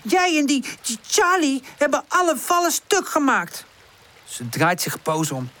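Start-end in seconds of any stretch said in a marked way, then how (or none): 0.0-3.7 high, worked-up protest; pitched-up, affronted arguing; indignant outburst. A narrator's reading. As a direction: hurl the blame straight back. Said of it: "roept Pip uit".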